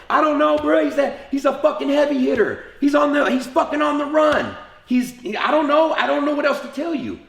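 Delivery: cornered and begging them to believe you; retold panicky yell; desperate pleading. Pressured under interrogation, pleading.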